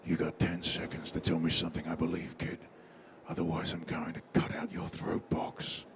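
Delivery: low, hushed threat breathed close to the ear; low whispered menace, dead calm; calm menacing whisper. Acted. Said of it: "whispered in, into your ear so calmly".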